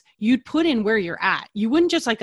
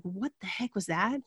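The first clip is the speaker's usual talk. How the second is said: hushed puzzled; 8 dB quieter than usual. Retold or imitated. Retold bewildered reaction in kitchen.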